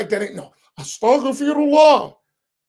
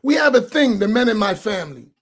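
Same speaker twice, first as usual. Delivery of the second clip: usual speaking voice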